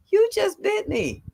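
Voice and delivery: high-pitched, indignant retold shock; indignant disbelief. Nana to the great-grandbaby who bit her, indignant. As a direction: voice up high, dropping at the end. Shocked retort to biting child.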